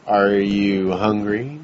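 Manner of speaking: a low, creepy drawl asking the question; slow, low Darth Maul fan. Retold or imitated. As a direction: drag it out low and slow like a menacing Sith. Voicing fan dressed as Darth Maul.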